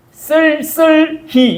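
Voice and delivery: "쓸쓸히" slow, each syllable separate with tense ss; "쓸쓸히" in deliberate, drawn-out standard Korean; careful standard pronunciation. Shown as a demonstration. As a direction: spell out "쓸쓸히" with hard double-s sounds. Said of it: "경상도 사람 제일 발음 못하는 게".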